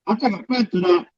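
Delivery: muffled, boxed-in bad mic sound; like talking from inside a cardboard box. Real, not acted. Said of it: "Sounds like you're talking from inside a box".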